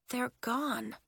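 soft, stunned realization; hushed incredulity; disbelief. A narrator's reading. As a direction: say it small, barely believing it. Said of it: "Willa said in disbelief".

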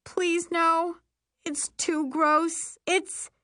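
high-pitched, pleading dismay; horrified dread. A narrator's reading. Girl falling toward manure, horrified. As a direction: plead high as disaster closes in.

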